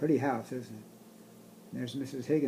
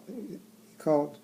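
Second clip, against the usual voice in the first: ordinary delivery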